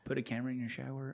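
quiet mutter. Real, not acted. Original line, muttered.

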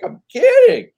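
high, loud frantic plea; panicked kidding. Retold or imitated. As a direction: squeal that it was only a joke. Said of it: "I'm just like".

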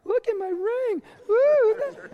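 high squealing show-off voice; gushing fiancée. Retold or imitated. Fiancée showing off her ring at party, high squeal. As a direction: squeal over the new ring. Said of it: "she spends the whole time walking around".

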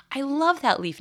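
very high, sing-song over-the-top praise; gushing mom praise. Retold or imitated. Mom gushing in a very high voice over her son's craft. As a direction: gush like a mom faking delight at a craft.

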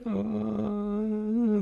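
sick ghost groan. Retold or imitated. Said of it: "He's like".

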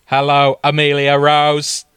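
monotone robotic Dalek greeting. Acted. Dalek best friend greets Amelia at the door, mechanical monotone. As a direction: greet her as a stiff Dalek. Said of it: "He greets me in his tender, screaming voice".

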